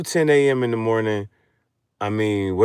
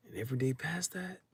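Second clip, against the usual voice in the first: breathy whisper, a bit higher and quicker; whispered quote; 6 semitones higher, 13 dB quieter, faster than usual. Retold or imitated. To viewers, imagining a lost streak, whispered. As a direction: hush it like a secret lament.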